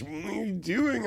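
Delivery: high, drawn-out puzzled question; imitated puzzled partner. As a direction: play a bedmate puzzled by a sleepwalker. Partner questions the sleepwalker, retold.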